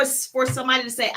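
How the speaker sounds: ordinary talk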